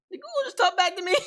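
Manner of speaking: high, laughing disbelief; mock shock. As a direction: squeak it out in amused shock. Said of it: "You're like".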